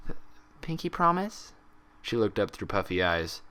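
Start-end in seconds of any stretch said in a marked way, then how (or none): 0.1-1.5 high, tentative question; hoping it's true; small hopeful plea. Acted. Pinkie asking Applejack to promise, small and high.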